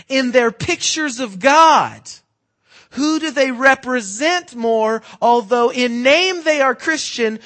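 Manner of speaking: loud, slow, rising preacher's call; full-force preaching. Acted. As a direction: drive the point home to the congregation.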